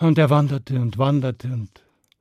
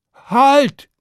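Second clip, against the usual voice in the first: high, loud call to stop; shouted command; 11 semitones higher, 8 dB louder than usual. Acted. Stan calling to the approaching dragon to halt, shouting. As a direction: call out sharply across a distance. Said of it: "rief Stan dem Drachen zu".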